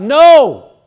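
emphatic no. Real, not acted. Preacher correcting the congregation, emphatic loud no. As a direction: cut it off firmly.

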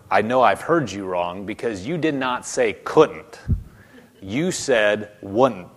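emphatic, with a drawn-out "You said wouldn't"; insistent disbelief. Retold or imitated. Man disputing what Jesus told him, emphatic insistence on "wouldn't". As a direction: insist on it, pausing before a slow "You said wouldn't". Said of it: "shaking his head going".

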